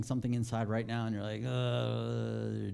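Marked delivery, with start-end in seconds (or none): 1.5-2.7 long, drawn-out groan of discomfort; acted "uhhh" of reluctance; mock grumbling groan. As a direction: groan like a put-upon listener. Acting out a listener's inner discomfort.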